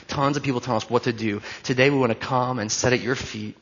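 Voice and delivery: soft, earnest prayer voice. Acted. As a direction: pray over the room, hushed and sincere. Leads the congregation in prayer.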